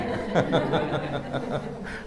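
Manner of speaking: short quiet chuckle; soft amused chuckle; soft laugh. Real, not acted. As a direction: laugh lightly at your own joke. Laughs at own pantun joke.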